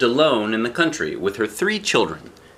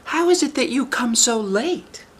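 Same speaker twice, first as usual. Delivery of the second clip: put-on squeaky child calling through a door; high small voice, wary; high little girl voice. Eldest daughter asks who knocks, in a high child voice.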